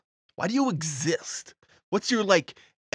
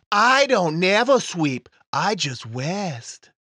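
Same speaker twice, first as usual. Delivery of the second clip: put-on little boy voice; 3 dB louder, much slower than usual. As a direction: drawl it like a creepy child. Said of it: "a little boy slash physical manifestation".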